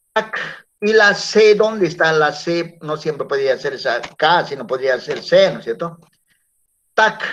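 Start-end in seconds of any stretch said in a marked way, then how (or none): 0.1-0.7 tac with an audible final k; syllable tac alone. Shown as a demonstration. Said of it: "hasta ahí, ¿cómo se lee?". 6.9-7.2 Tac ending in a released k; only "Tac", cut before the s; syllable tac alone. Shown as a demonstration. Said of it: "hasta ahí".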